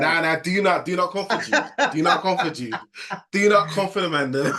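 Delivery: high, loud playful objection; mock protest. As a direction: tease back at the host, hamming it up. Playful protest over a teammate.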